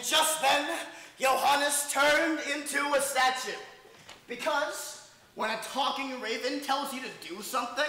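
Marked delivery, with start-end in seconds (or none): none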